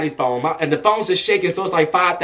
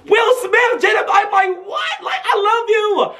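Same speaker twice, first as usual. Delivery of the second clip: high-pitched fangirl squeal, acted out; starstruck inner scream. Fan screaming in their head at [name], starstruck squeal. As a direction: shriek it like a secretly starstruck fan. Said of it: "in my head, I was saying that".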